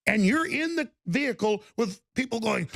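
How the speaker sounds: ordinary talk, nothing marked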